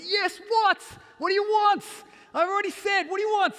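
acted furious shout, high and very loud; exasperated dad yelling. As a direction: blow up at a pestering child. Throttling weeds, yells at daughter.

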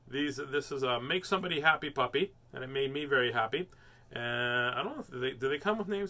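ordinary delivery, nothing marked

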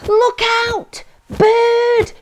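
high, loud shout of alarm; panicked cry. Acted. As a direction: shriek a warning as terrified snails. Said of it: "cried the snails in a panic".

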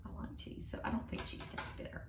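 unvoiced quick aside; whisper. Real, not acted. Teacher whispering her cheese opinion aside to the student. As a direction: breathe the words, almost to yourself.

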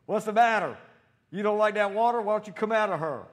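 high, loud mocking challenge; loud sneering command; taunting the demon. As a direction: goad the demon out of her. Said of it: "So I said".

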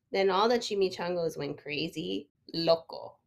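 ordinary delivery

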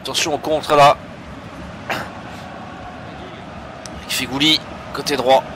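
live match commentary, building energy; play-by-play commentary. Acted. Live commentary on his own match.

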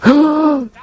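sharp excited intake of breath; excited gasp. Real, not acted. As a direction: gasp with delight. Excited discovery.

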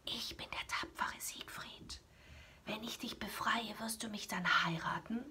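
soft secret whisper. Acted. Siegfried sneaking up to Kriemhild, whispering a proposal. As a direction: breathe it so the dragon won't wake. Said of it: "flüsterte der erstaunten Schönen zu".

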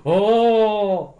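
loud, high, drawn-out "oh", imitated; mock tipsy whoop of delight; drunken fan's cheer. Drunk passer-by reacting to the French accent, mock delight. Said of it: "I think they were drunk".

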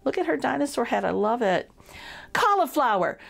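usual speaking voice

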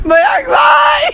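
loud, high shout across the roof; calling out. Real, not acted. Shouting across the rooftop to Mayank, loud high call. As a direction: call him from far away.

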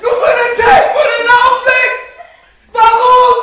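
very high, very loud yelling; all-out performed outburst; full shout. Acted. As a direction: scream it out at the peak of a rant. Climax of stage rant on clocks.

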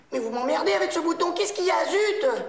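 high, loud irritation; exasperated outburst. Acted. Assistant fed up about the button, exasperated outburst.

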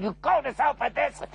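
high, scornful mock rebuke; lightly voiced angry uncle; mock anger. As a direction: uncle cursing his nephew in a light mock snarl. Abu Lahab cursing nephew.

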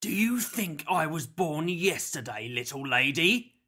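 sneering kidnapper, building to a loud crow; theatrical villain's scornful gloat; mocking villain. A narrator's reading. Villain's dialogue in narrated story.

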